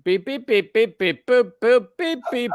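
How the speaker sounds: rising string of high electronic beeps; imitated computer beeps. Mocking a CSI computer, imitated beeping. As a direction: beep and boop like a TV computer.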